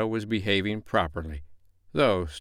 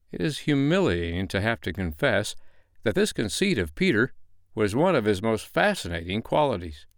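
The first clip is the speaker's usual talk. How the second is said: plain reading voice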